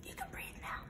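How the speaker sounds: soft unvoiced whisper. Shown as a demonstration. Reader softly releasing young listeners from the held breath. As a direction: let them breathe in a hush.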